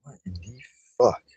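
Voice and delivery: very soft, low disbelief; barely voiced, can't believe it; stunned mutter. Real, not acted. Number not available message.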